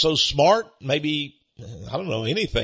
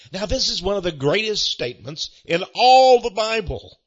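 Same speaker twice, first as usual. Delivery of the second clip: normal talking voice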